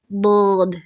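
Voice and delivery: usual speaking voice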